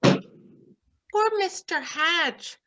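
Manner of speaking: plain reading voice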